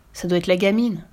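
plain reading voice

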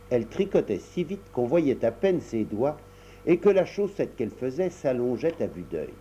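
usual speaking voice

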